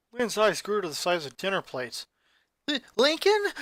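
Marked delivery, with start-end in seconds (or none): 2.7-3.5 high, stammering gasp of a name; stunned stammer. Acted. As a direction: catch your breath on his name. Shocked at news of brother.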